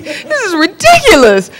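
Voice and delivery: high laughing aside; amused high remark. Real, not acted. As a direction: laugh at how absurd it was. Laughs at own story.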